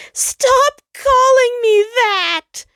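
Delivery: high, loud, slow tearful scream; the sun screaming at Jupiter in tears; crying shout. Acted.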